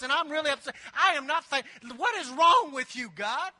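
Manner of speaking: hammed-up grumbling prayer; mock complaint. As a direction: whine at God, building to indignation.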